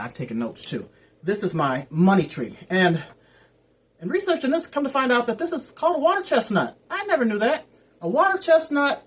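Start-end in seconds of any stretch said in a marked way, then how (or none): 4.0-9.0 surprised discovery. Real, not acted. Surprised at plant's real name.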